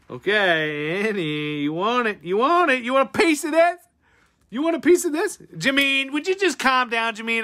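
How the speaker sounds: playful taunt climbing to high, loud yelling; mock challenge. Streamer daring Annie to battle, mock challenge building. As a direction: start teasing, build to a hammed-up high shout.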